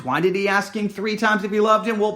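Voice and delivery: usual speaking voice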